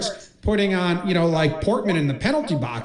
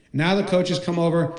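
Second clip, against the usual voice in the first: normal talking voice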